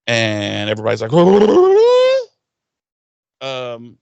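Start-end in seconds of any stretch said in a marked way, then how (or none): 1.1-2.2 loud high startled gasp; a squeal of alarm; high shocked gasp-laugh. Retold or imitated. Everybody hearing Glory is after the witch, high loud gasp.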